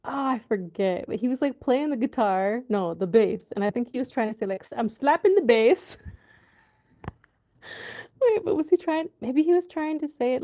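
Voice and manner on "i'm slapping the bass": loud imitation of a muddled Jamaican accent; botched Jamaican accent. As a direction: imitate a film's failed Jamaican accent, slipping toward Scottish. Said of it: "in a Jamaican accent".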